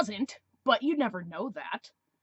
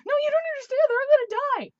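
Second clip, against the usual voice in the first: put-on much higher, louder squealy teen girl; 8 dB louder, faster than usual. Girl in the theater turning to her, high squealy teen. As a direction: gush it like a frightened thirteen-year-old. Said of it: "literally turns to me and goes".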